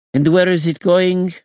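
high, loud woman's voice; shrill female voice. A narrator's reading. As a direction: call out high and sharp. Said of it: "asked a shrill female voice".

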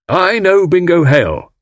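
high, emphatic declaration; impassioned defence of a friend; defiant loyalty. A narrator's reading. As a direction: insist on it with total conviction. Husband defiantly defends his friend.

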